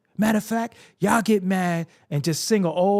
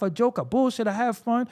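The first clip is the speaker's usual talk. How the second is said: normal talking voice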